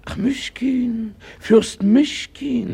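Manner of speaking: very high and slow, breathless with awe; astonished. Acted. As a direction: gasp out the name in disbelief. Astonished at hearing a prince's name. (fassungslos staunend, sehr hoch und langsam)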